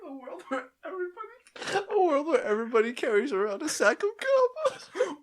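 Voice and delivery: high mock crying lament; mock wailing. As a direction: wail it like a tragic lament. Wailing about a world of sacks.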